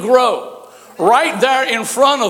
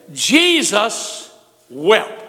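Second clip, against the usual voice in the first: solemn slow reading; much slower than usual. Acted. Preacher dwelling on scripture.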